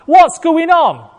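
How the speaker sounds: high, loud, desperate cries of a blind beggar; blind man calling out. Acted. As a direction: call out high and loud, lost in the crowd. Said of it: "he's saying to people".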